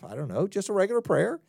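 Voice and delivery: ordinary talk, nothing marked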